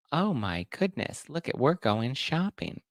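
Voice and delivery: a light send-up of a posing reality star; mock gushing celebrity. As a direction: play a celebrity staging a candid moment. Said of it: "They were just like".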